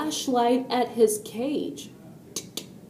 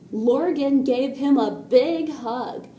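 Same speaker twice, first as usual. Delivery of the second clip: ordinary delivery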